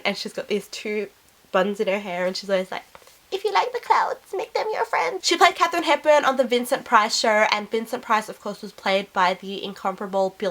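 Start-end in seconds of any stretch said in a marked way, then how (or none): 3.3-5.3 put-on high, whimsical Icelandic accent. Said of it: "She's just got the accent".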